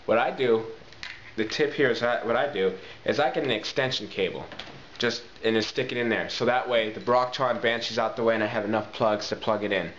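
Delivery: usual speaking voice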